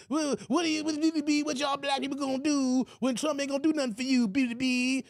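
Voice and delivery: high Porky Pig stammer; Roland Martin impression. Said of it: "he Porky Pig".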